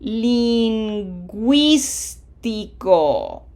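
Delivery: "lingüístico" very slow, syllable by syllable; slow teacher's model of "lingüístico"; pronunciation model. Shown as a demonstration. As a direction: stretch "lingüístico", sounding the w and stressing GÜÍS. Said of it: "Hopefully you came up with".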